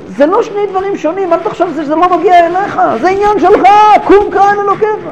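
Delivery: high, pleading call to wake up; urgent ship's captain. Acted. Captain shaking Jonah awake on the stormy ship, high urgent plea. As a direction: rouse the sleeping prophet, high and insistent. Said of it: "מגיע אליו ואומר לו".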